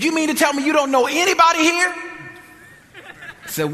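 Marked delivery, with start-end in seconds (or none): 0.0-1.9 very high, loud disbelieving question; retold incredulity. Woman questions strangers who prayed, retold incredulity. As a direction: imitate her amazement, pitched way up.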